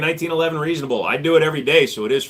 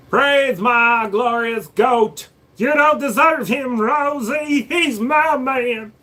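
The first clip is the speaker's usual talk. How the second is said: hammed-up hype voice; 9 semitones higher, much slower than usual. Hyping a chat member.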